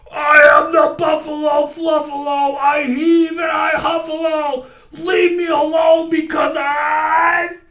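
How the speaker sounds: high, loud blustering roar; snarling bellow. A narrator's reading. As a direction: puff up and bellow. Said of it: "snarled and gargled and stomped".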